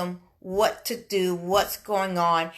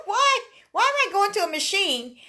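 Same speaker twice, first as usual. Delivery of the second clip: indignant; 10 semitones higher than usual. Retold or imitated. Retelling cable store guy.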